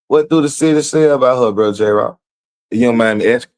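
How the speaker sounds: dying to know the gossip; nosy excitement. Real, not acted. Nosy asking about her.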